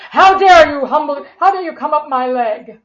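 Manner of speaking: loud, indignant mock scolding; mock angry father. Father scolding the child climbing his leg, mock outrage.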